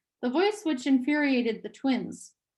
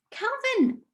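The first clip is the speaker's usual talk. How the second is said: a high, frightened call for help; gasped cry; 12 semitones higher than usual. Acted. A girl calling to her friend as her brother pulls free, gasping. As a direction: cry out his name in alarm, much higher. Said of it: "she gasped".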